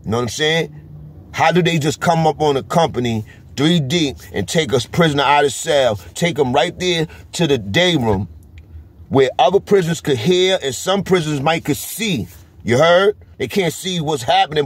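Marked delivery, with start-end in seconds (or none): none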